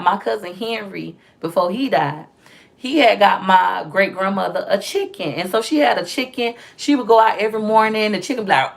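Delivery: usual speaking voice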